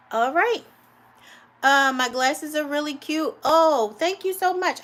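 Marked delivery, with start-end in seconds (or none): 3.4-4.8 high, bright thank you; delighted thanks. Real, not acted. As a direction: gush with gratitude. Thanks viewer for a compliment.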